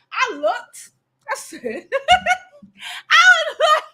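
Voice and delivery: high, laughing through the words; can't believe it, cracking up; laughing disbelief. Real, not acted. To co-host about the views, laughing in disbelief.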